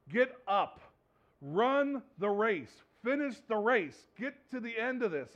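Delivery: loud, slow, drawn-out rallying cry; urging shout. Retold or imitated. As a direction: push him on to the finish line. Father urging son to finish.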